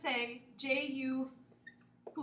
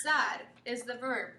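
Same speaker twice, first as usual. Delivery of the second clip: ordinary delivery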